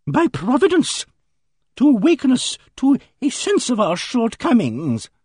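high, insistent lady's voice; earnest Emily Barton. A narrator's reading.

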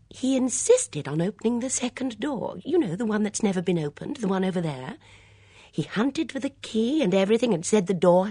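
excitable high, loud chatter; fluttery woman blurting out news; Miss Bunner. A narrator's reading. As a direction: prattle on, pitched high and eager.

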